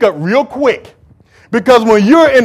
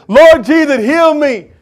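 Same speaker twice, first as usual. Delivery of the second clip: raised pleading cry to Jesus; calling out; 5 semitones higher, 4 dB louder, slower than usual. Acted. Blind man calling out to Jesus, raised pleading cry. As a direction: call out loud, begging to be healed. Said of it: "He's calling out Jesus' name".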